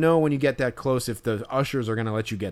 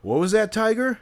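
much higher, louder mock taunt; mocking pitcher; 11 semitones higher, 5 dB louder than usual. Fan imitating a pitcher taunting a kid, mocking. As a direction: sneer it down at a kid. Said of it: "he goes, like".

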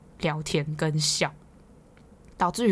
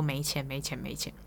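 mocking sing-song chant, trailing off softer and lower; teasing taunt; 3 semitones lower, 8 dB quieter than usual. Coworkers teasing the broke senior, mock taunt fading softer. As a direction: needle him with a fading, lower teasing repeat. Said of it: "一直被酸说".

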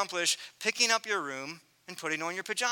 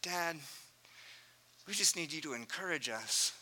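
softer, gentle and plain; calm child; 2 semitones lower, 6 dB quieter than usual. Retold or imitated. Six-year-old calmly answering his upset dad. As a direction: say it simply, no drama. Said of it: "Calmly, he says".

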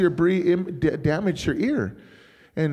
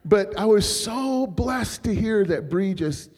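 ordinary delivery

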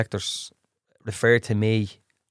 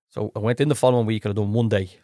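usual speaking voice

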